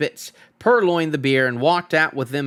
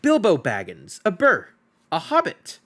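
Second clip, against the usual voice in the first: frightened hobbit; 5 semitones higher than usual. Acted. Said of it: "shaking all over".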